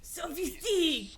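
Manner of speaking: high, slow mock French accent; shitty French accent. Viewer mimicking a snobbish cooking-game chef, bad French accent. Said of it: "influence my shitty French accent".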